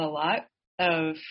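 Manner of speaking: ordinary talk, nothing marked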